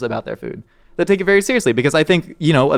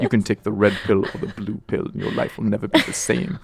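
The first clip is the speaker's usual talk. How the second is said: softer, low solemn Morpheus impression; 3 semitones lower, 6 dB quieter than usual. Imitating Morpheus offering the red or blue pill, solemn. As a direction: offer the choice like a grave mentor. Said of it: "Morpheus from the Matrix".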